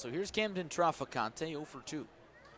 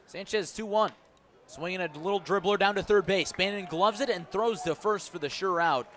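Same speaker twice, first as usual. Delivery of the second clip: excited play call; 5 semitones higher, 6 dB louder than usual. Real, not acted. Live call of a grounder.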